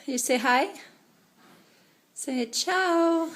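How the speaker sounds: high-pitched coaxing. Real, not acted. Coaxing the baby to say hello.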